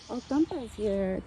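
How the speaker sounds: usual speaking voice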